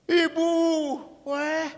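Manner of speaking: put-on high, slow, sweet-talking old priest; butter her up, stretching the compliments; flattering old priest. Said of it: "pastor itu sudah berkata".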